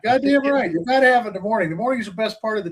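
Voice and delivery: high, loud and fast, pushing hard; real excited push for the morning; emphatic insistence. Real, not acted. Senator insists on morning talk.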